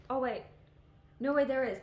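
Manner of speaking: sudden loud burst of surprise; blurted out, caught off guard; loud surprised. Real, not acted. Finding a missed card.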